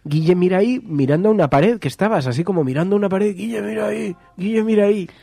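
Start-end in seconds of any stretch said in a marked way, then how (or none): 0.0-0.8 higher, louder, urgent half-slurred cry; panicked garbled plea. Retold or imitated. Friend mimicking his sleep-paralysis words, higher, urgent. 3.4-5.1 panicked garbled plea. Retold or imitated. Friend mimicking his sleep-paralysis words, higher, urgent.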